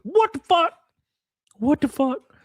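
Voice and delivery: high, shocked "What the fuck?"; real disbelief, pitched high; shocked outburst. Real, not acted. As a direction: blurt it out stunned. Shocked reaction.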